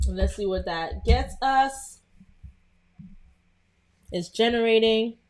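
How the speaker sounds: usual speaking voice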